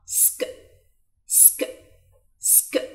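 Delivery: "سك" as one short s-plus-k sound; sk sound demonstrated. Shown as a demonstration. Teacher showing learners how S K is pronounced, isolated sk sound. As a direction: hiss the s into a quick k for "سك". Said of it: "بتتنطق".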